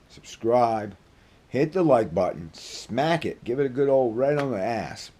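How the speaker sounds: rally the viewers to smack that button; hyped-up plea. Real, not acted. To viewers, begging for subscribes, pumped and loud.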